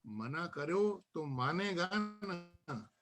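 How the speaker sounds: measured verse, lines set apart by pauses; poem recitation. Acted. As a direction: let each refrain fall away at the end. Recites own nazm to gathering. (नज़्म का ठहर-ठहर कर पाठ)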